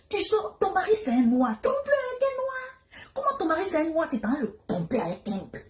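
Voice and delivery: high, incredulous telling-off; mock scolding. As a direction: scold her with disbelief. Scolding the aunt's choice.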